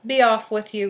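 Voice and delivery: loud, grudging troll dismissal; booming storybook monster; growling troll. A narrator's reading. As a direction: growl it out and send the goat off. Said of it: "growled the troll".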